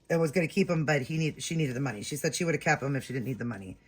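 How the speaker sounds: normal talking voice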